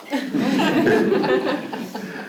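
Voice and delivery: very high, light giggle; laugh. Real, not acted. Speaker tickled by uncle's pollution advice, high giggle. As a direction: giggle after the punchline.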